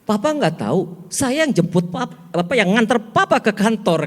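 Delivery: shrill-pitched mock telling-off; snapping wife. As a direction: snap at your husband in an imitated wife's voice. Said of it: "bentak dia".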